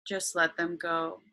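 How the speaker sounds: slow, calm meditation voice; guided meditation. Acted.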